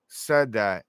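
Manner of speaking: ordinary talk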